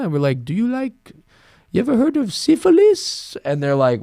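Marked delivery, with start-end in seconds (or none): 0.4-3.4 high, teasing silly voice; mock high question. Friends teasing French girls on beach, high mock question. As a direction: pitch it way up to tease.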